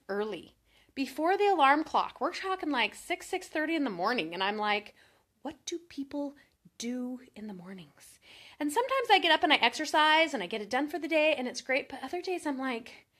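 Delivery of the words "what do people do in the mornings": hushed whisper. Retold or imitated. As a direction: whisper it to yourself, baffled. Puzzled early riser asking herself.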